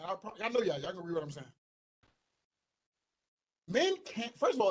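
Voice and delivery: ordinary delivery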